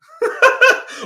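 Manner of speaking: sudden high laugh bursting out; loud burst of laughter. Real, not acted. Streamer cracks up at comment.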